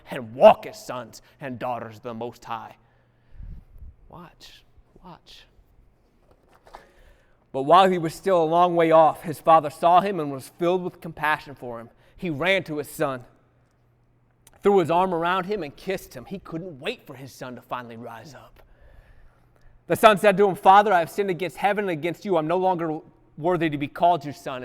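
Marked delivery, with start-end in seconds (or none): 4.1-5.5 breathy whispered watch, watch; soft hush to the crowd. Real, not acted. Preacher hushes the congregation. 7.5-24.6 loud, rising sermon delivery; loud gospel storytelling; full-force preaching. Acted. Preaching the prodigal son.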